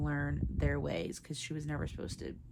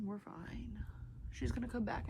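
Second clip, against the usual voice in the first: soft unvoiced whisper of we're fine; whispered self-comfort; 6 semitones higher, 6 dB quieter than usual. Real, not acted. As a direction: whisper to calm yourself.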